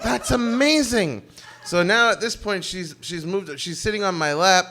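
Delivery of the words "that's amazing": put-on slow, awed girl voice; impressed girl. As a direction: drag out the awe like a starstruck fan. Impressed girl in retold story.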